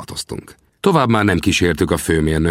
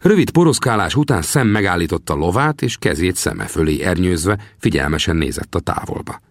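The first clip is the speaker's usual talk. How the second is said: ordinary delivery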